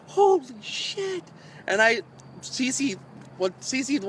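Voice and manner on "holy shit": high, slow stunned cry; retold panicked holy shit, pitched way up; shocked exclamation. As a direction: gasp it out as the rod flies off. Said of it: "I'm like".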